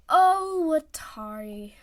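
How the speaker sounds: loud, drawn-out fond pity; patronizing. Acted. Oikawa to Watari, patronizing. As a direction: pat him with the voice.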